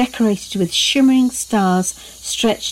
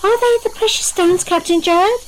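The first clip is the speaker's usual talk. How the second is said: put-on very high, little-girl question; high-pitched wondering question, rising at the end; inquiring child; 12 semitones higher than usual. A narrator's reading. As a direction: ask the captain in a high, curious voice. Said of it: "inquired Maisie".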